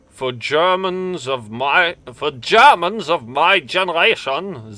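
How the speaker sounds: put-on a solemn German elder's warning in a heavy accent; heavy German accent rising to a hushed end. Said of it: "I had to do that in a German accent".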